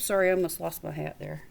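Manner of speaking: ordinary delivery, nothing marked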